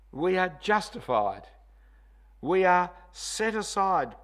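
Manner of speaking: slow, loud stress on are; emphatic declaration. Shown as a demonstration. Preacher proclaiming what believers are. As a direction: declare each word with weight. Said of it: "remember that word".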